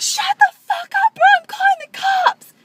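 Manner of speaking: high, loud mock shriek; imitating her yell. Retelling how the crazy woman yelled at them. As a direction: scream it like the crazy woman did. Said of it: "she was like".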